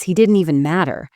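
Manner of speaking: plain reading voice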